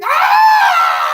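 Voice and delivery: long, very high, loud scream; agonized scream. Retold or imitated. Steak screaming in agony as it is cut, tortured scream. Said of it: "she's hearing".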